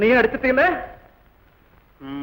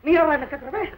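usual speaking voice

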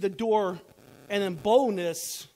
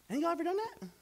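usual speaking voice

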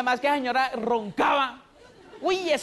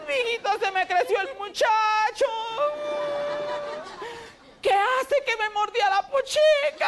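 much higher, slow doting mom voice; doting mother; 11 semitones higher than usual. Retold or imitated. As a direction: coo it like a proud old mother. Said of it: "Su mamá".

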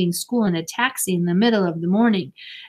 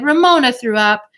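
eager; 5 semitones higher, 7 dB louder than usual. A narrator's reading. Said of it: "Marcia was eager to break the news".

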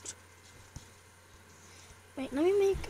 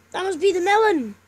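excited realization; 5 semitones higher, 11 dB louder than usual. Real, not acted. Discovers clue.

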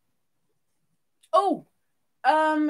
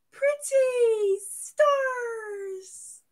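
very slow, high sing-out; playful gushing; 7 semitones higher, 5 dB louder, much slower than usual. Pretend. Streamer reading out viewers' star emojis, gushing. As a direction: stretch each word out high and loud.

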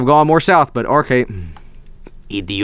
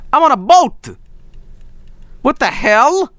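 high, hammed-up bewilderment; incredulous outburst with rising questions; mock shock; 12 semitones higher than usual. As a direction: pitch it up in exaggerated disbelief. Mock shock at being on boat.

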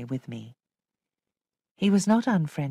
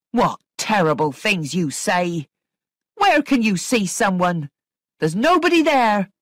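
indignant, rising louder and higher; angry; 4 semitones higher, 7 dB louder than usual. A narrator's reading. Missus Grose protesting that nobody is there, angry. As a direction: build the indignation to a loud protest. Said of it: "But Missus Grose was angry".

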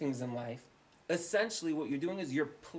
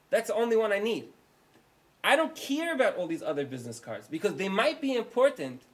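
higher and louder, hammering the point; frustrated shouting; 6 semitones higher, 8 dB louder than usual. Acted. Homeowner yelling at the repairman before Pesach, frustrated shouting. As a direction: vent at him, fed up and urgent. Flooded homeowner losing patience.

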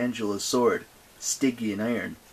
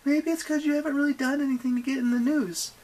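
high-pitched needling bluff; feigned-casual young heroine; 6 dB louder than usual. Acted. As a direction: chatter high, slighting the goddess.

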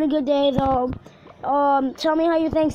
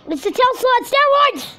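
high loud shout of alarm; excited yell; 10 semitones higher, 5 dB louder than usual. Real, not acted. Gamer shouts at a huge enemy tower.

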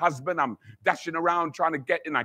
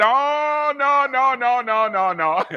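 high, loud no no no at the devil; defiant refusal; 6 semitones higher, 5 dB louder, slower than usual. Retold or imitated. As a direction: rebuke the devil, no backing down. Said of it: "I'm talking to the devil now".

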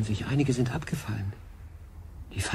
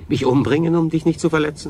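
loud, higher despairing cry; desperate outburst; 5 semitones higher, 6 dB louder than usual. Acted. Adrian crying out in despair to Berenice. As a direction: fling it out in despair.

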